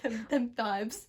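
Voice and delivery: slow, drawn-out slangy them vibes; mock slang. Answering her friend's interview, playful slang. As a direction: stretch it out for fun.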